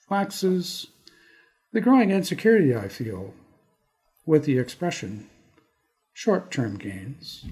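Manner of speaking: measured, dramatic poem recital with long pauses; poetry reading. Acted. Poet reading his Toronto poem for podcast listeners, measured and dramatic. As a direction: perform the poem, letting each image land.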